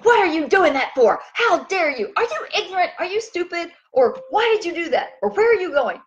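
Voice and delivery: high, sharp accusing questions; hammed-up angry nagging; mock hateful scolding. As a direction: scold like you're fed up. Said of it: "if I was being very hateful to you".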